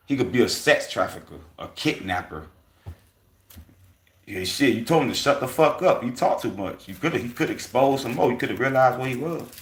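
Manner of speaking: heated loud talk. Real, not acted. Heated warning about the man.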